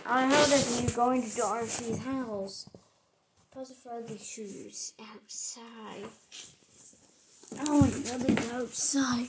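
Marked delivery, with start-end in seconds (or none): 3.5-6.1 soft slow unvoiced whisper. Real, not acted. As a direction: whisper it as a secret. Whispered aside.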